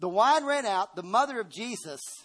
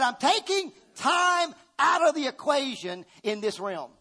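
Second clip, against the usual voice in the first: voicing God higher and louder, declaring; God speaking; 6 semitones higher, 4 dB louder than usual. Preacher voicing God's promise to the church, raised and emphatic. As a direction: proclaim it as God's own words, lifted high. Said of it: "He said".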